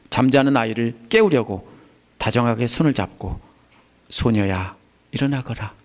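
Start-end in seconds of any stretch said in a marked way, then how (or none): 4.1-5.7 mother gently waking her daughter; tender waking call. Retold or imitated. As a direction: wake a sleeping child like a mother. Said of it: "엄마가 손을 잡은 것처럼".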